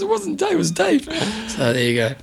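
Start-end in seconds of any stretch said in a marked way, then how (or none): 0.0-1.0 high mock girly voice; mimicking the girlfriend. Retelling his girlfriend's reply in a high mock voice. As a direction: squeak it out like she did. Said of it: "And she goes".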